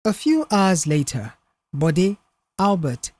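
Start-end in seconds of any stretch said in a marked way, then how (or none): none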